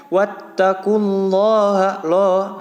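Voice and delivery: "wattaqullaha loh" chanted slowly, loh rounded and held. Shown as a demonstration. Teacher demonstrates the rule on the verse, rounded loh recitation. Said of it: "maka dibaca loh".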